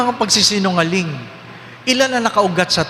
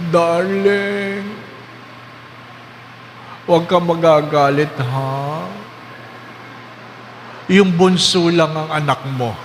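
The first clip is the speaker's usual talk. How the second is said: dying wife confessing; 3 semitones lower, slower than usual. Retold or imitated. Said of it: "Sabi niya".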